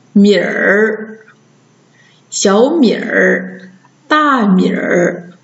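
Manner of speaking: 米儿 blended, vowel gliding into curled r; erhua demo. Shown as a demonstration. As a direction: slowly model 米儿, 小米儿, 大米儿 with r ending. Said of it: "直接加儿一起读".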